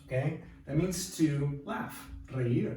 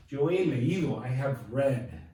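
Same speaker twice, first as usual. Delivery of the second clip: usual speaking voice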